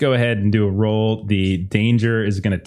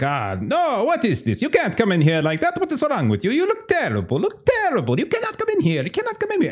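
high, fussy, scandalized guard voice; high-pitched disgusted doorman; snooty gatekeeper men; 13 semitones higher than usual. Acted. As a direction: recoil at the muddy girl in a high, prissy voice. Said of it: "you hear the men like".

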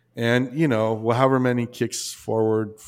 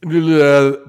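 loud, higher burst of blame at the instructor; louder and higher, a retold angry thought; retold outrage; 4 semitones higher, 10 dB louder than usual. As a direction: vent at the instructor, punching it out loud. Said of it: "the other one was like".

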